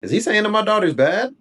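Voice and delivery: high-pitched, affronted disbelief; incredulous question. Retold or imitated. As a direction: ask it as if you can't believe the nerve. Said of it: "thinking about it in the moment".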